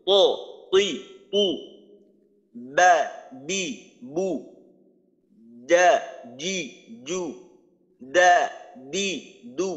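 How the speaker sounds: each syllable struck hard and bounced; teacher drilling strong letters; qalqala syllables. Shown as a demonstration. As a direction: drill the syllables with a firm push. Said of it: "এই হলো কলকলার পাঁচটা উচ্চারণ".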